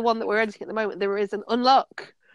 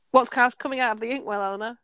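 usual speaking voice

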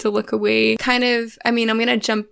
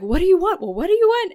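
high-pitched demanding yell, building higher; mock yelling; 8 semitones higher, faster than usual. Man arguing with his dance partner, mock yelling. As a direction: imitate an exasperated yell, pitch climbing. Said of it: "yelling at her".

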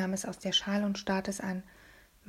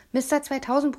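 higher, louder outburst into the phone; yelling; 5 semitones higher, 5 dB louder than usual. A narrator's reading. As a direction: blurt it out in a raised yell. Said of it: "brüllte ich los".

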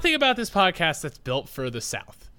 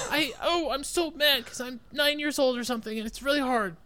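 put-on high, whiny nine-year-old; mock kid voice; 8 semitones higher than usual. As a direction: complain in a high put-on child voice. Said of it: "All the kids sound the same".

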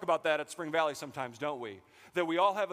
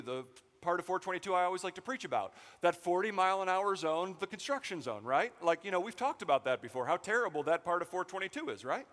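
normal talking voice

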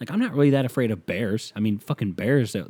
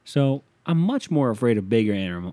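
ordinary talk

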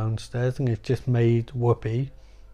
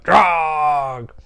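croaky crone noise; 3 semitones higher, 4 dB louder than usual. Retold or imitated. Grandma Death voiced.

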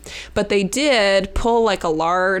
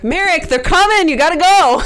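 loud high call across the room; calling from far away; 9 semitones higher, 8 dB louder than usual. Acted. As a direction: shout a warning across the bar. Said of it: "I'm gonna yell over to Merrick".

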